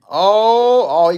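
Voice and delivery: excited. Real, not acted. Takedown lands live.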